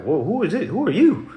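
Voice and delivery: high, mock-bewildered questions, climbing at the end; acting lost. Followers asking who the speaker is, imitated as high-pitched confusion. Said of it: "Folks be acting lost".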